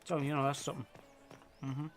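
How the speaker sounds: talking with a mouthful; mouth full. Real, not acted. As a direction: speak through food in the mouth. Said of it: "talking with their mouth full like me".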